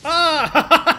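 loud high scream of fright; startled scream. Real, not acted. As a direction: shriek at a jump scare. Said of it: "That jump scared me!".

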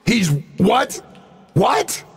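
high, rising disbelief; stunned double-take, pitch leaping up; incredulous. Acted. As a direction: question it in sheer astonishment. Shocked that creature is informant.

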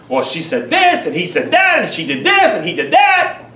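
high, singsong mock complaining; mock the petty tit-for-tat complainer; whiny grudge-holder. Preacher mimicking a congregant listing grievances, high mocking whine.

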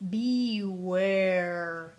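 one long, drawn-out booming horn note. Acted. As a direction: let it boom out across the foggy sea. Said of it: "boomed Ollie's big horn".

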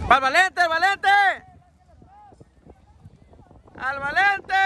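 high repeated sideline yell; calling out. Real, not acted. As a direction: urge a pass across the pitch. Calling for a pass to Valente. (grito pidiendo el pase)

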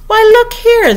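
very high, loud exclamation; exclaiming cry. A narrator's reading. Red Queen explaining to puzzled Alice, crying out. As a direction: cry out to demand attention. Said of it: "the Red Queen cried".

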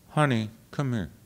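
put-on soft, low, quick summons as the old coach; Helen Hodem. Coach calling her singer over on stage, hushed imitation. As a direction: imitate the coach beckoning quietly. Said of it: "And she said".

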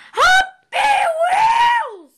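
bad impression, very high drawn-out yell; Jacksepticeye. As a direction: stretch a loud shriek like a hyped YouTuber intro. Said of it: "Sorry, bad impression".

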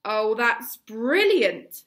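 slow, very loud sarcasm; sarcastic bellow. A narrator's reading. Gobber mocking Hiccup before the boys, slow sarcastic bellow. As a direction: drag it out and bellow the scorn. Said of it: "bellowed Gobber".

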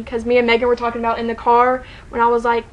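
ordinary delivery

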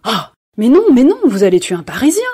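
loud high protest, no no; cried out. Acted. Georges cries out to save the chair, loudly. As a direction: cry out to stop her. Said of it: "s'écria Georges Clérin".

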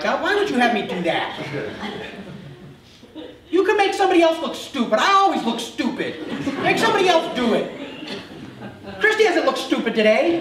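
hammed-up fuming at God; fuming complaint. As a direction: complain like a wronged child. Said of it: "I'm like".

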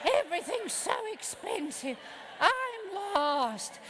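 drawn-out, whiny grumble; mock whining complaint. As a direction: whine like a spoiled, weary tourist. Mocking complaining grown-ups at a resort.

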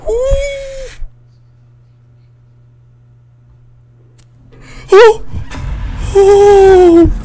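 very high, loud shrieks; startled scream. Real, not acted. Player hit by jump scare, screaming. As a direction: scream out in sudden fright.

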